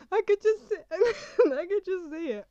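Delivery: high, loud laughing-crying through the words; real giggles breaking into tears; laughing till crying. Real, not acted. Picturing herself kissing her figures in prison, laughing till crying. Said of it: "I'm actually crying".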